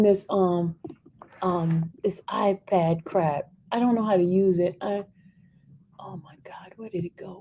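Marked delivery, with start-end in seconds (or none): 6.0-7.4 hushed, much softer whisper; whispering to self. Real, not acted. Reader hunting for the lost place, whispered. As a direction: whisper it under your breath.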